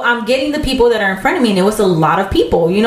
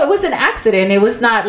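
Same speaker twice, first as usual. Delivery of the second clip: usual speaking voice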